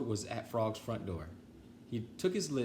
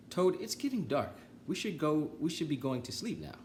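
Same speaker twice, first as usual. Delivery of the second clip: higher, gentle Frog; 7 semitones higher than usual. Acted. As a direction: coax him home kindly. Frog voiced in story.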